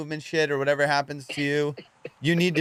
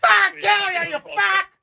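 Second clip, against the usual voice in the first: wild high-pitched screaming rant; crazy homeless yelling; 6 dB louder, slower than usual. Retold or imitated. Mimicking homeless people screaming outside Andy's apartment, wild high shout. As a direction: belt it out like a deranged street screamer. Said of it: "crazy homeless people going".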